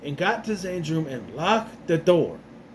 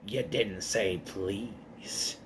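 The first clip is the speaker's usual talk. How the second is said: low, very slow, creepy ventriloquist-dummy voice; 8 semitones lower, 10 dB quieter, much slower than usual. Retold or imitated. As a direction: drag it out low and eerie like a sinister doll. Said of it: "I love Slappy's reply".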